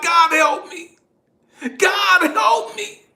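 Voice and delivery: high, loud desperate prayer; crying out to God. Retold or imitated. Man in the tent begs God for help, crying out. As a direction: cry out for help at full voice. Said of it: "I'm crying out to God".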